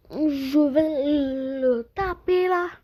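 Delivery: normal talking voice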